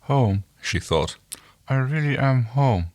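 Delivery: lips pressed, talking from the mouth corner. Acted. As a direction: speak without moving your lips. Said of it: "talk out of the corner of my mouth".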